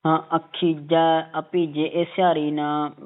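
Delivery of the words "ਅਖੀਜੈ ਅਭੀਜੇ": ਅਖੀਜੈ slowly as "akhijaa"; slow model with open ending on ਅਖੀਜੈ; corrected vowel demo. Shown as a demonstration. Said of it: "ਏਦਾਂ ਇਹ ਸਿਹਾਰੀ ਨਾ ਪੜ੍ਹਨੇ".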